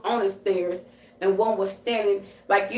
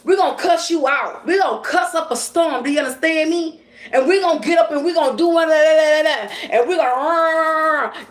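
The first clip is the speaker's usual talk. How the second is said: high-pitched mad rant, building louder; acting furious; 6 semitones higher, 4 dB louder than usual. Showing viewers how a truly mad person cusses, acted furious. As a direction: act out someone really mad and cussing. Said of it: "when a person really fucking motherfucking mad".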